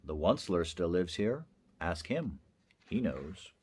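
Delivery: low, hushed and ominous; hushed storytelling. A narrator's reading. Narrator hushing listeners about the Onceler, low and secret. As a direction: draw the listener in with a hushed hint.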